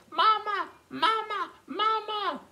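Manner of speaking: put-on very high-pitched little baby calls; baby penguins calling. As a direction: call for mother like tiny penguins. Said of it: "five baby penguins look around calling".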